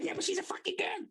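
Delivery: high mocking mum voice; mock angry mum. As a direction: squeak it out like a furious mum. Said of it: "my mum went ballistic".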